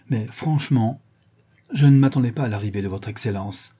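usual speaking voice

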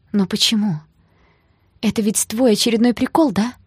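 agitated hope climbing in pitch; painfully hopeful. A narrator's reading. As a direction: ask desperately, hoping it is a joke. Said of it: "с болезненной надеждой в голосе".

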